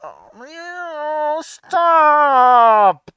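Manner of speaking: loud pleading stop; loud anguished no, stop; anguished cry. Real, not acted. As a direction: beg the story to stop. Pleading with the story.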